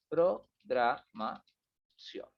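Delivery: very slow "pro-gra-ma-ción", with gaps; syllable by syllable. Real, not acted. Teacher typing without accent, very slowly in syllables. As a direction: break the word up as you type it.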